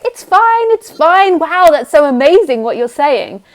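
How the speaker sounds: mock-chipper denial of annoyance; fake cheerful. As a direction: pretend everything is fine, too eagerly. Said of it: "And then I'd be like".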